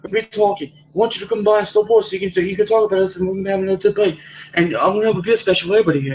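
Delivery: put-on English accent, eager and high. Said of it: "English guy, was like".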